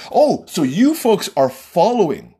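normal talking voice

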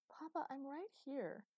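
put-on high, indignant little girl; a child fed up with her father's teasing; aggrieved tiny boss. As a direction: scold him like a pint-sized boss. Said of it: "She sounded aggrieved and disgusted, like a tiny boss".

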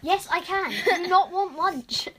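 laughing bossy order. Acted.

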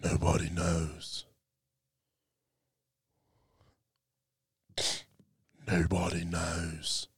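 slow, low and brooding mock Jon Snow; Jon Snow impression. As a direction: drag it out like a brooding hero. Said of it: "That's a great Jon Snow impression".

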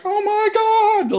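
very high mock wail; high-pitched, hammed-up astonished cry; tearful freak-out. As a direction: imitate shocked bystanders freaking out in tears. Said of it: "like, pouring tears. They're like".

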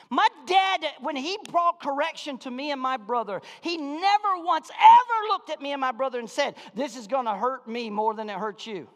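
loud, high-pitched preacher's shout; impassioned pulpit holler; full-force preaching. Real, not acted. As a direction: preach it at the top of your voice.

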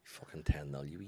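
hissed whispered jab; whispering. Real, not acted. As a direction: whisper the taunt. Unvoiced aside.

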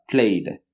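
"Played." as one syllable with the tongue tapping the palate for d; correct pronunciation. Shown as a demonstration. Teacher repeating "Played." with a clear final d. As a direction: end "Played." on a light d, no extra vowel. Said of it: "la lengua voy a tocar el paladar arriba".

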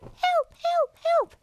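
very high piping help calls; starfish. Acted. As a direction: squeak for help like a tiny starfish. Mother echoes starfish cries.

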